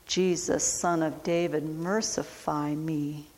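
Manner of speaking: soft, fading plea for mercy; hushed plea. Acted. Blind beggar begging Jesus, soft fading plea. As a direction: let the cry sink to almost nothing.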